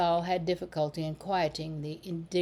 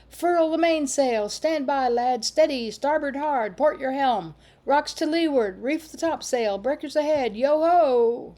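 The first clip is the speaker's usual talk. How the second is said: high, loud string of ship commands; calling out excitedly; 7 semitones higher, 6 dB louder than usual. A narrator's reading. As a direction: bellow orders like a captain in a storm. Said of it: "calling out excitedly".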